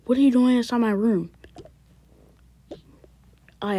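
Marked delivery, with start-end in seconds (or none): 0.0-1.3 loud, higher shout of surprise; startled yell. Acted. Son shouting at dad found in his room. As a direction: yell it, caught off guard.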